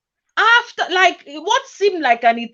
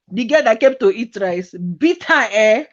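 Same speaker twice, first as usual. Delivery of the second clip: ordinary delivery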